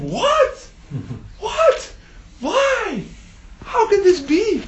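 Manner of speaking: very high squealing disbelief; shocked. Acted. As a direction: shriek the question, stunned. Rui fish hears shocking news.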